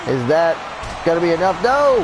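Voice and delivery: rising question bursting into a high no; build, then exclaim in disbelief; excited near-fall call. Real, not acted. Commentator watches the pin fail, excited outcry.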